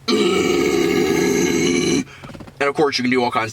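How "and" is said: beastly growl. Shown as a demonstration. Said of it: "a more beastly growl where it's like".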